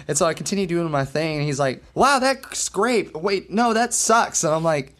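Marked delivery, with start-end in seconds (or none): 1.9-4.4 high, gushing praise flipped to a verdict; kid's supposed tic. Retold or imitated. Kid to drummer setting up, imitated sudden switch. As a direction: act out the kid's abrupt switch, pitched up. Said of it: "That's one of my tics".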